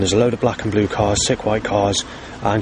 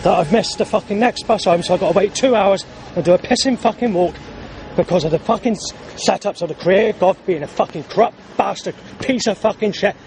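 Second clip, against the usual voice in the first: furious rant; 8 semitones higher than usual. Real, not acted. Missed bus, raging at god.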